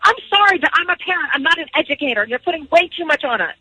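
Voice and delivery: ordinary talk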